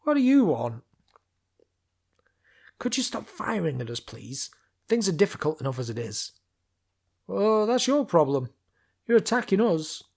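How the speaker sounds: high, loud guard voice, testy; guard shouting down. Acted. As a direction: yell down, fed up with the attackers.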